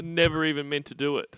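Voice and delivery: usual speaking voice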